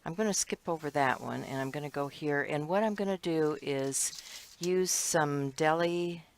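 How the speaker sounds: ordinary talk, nothing marked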